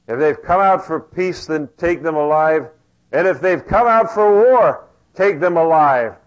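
loud swaggering king voice; brash and loud, full of himself; boastful king. Acted. Ben-Hadad boasting in a sermon.

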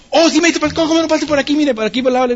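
high, flustered eager usher; sudden eager welcome. Retold or imitated. Funeral usher learns he is the minister, eagerly welcoming. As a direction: switch to bustling, deferential welcome. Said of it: "y cambia actitud completamente".

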